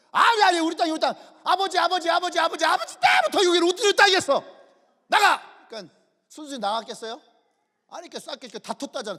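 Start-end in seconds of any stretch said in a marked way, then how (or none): 0.1-5.7 loud, high-pitched shouted protest; acted angry shouting; heated quarrel. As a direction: shout down a rival, insisting over and over. Acting out a land quarrel.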